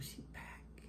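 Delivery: hushed whisper to oneself. Retold or imitated. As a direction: breathe it out very softly, almost soundless. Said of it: "I said to myself".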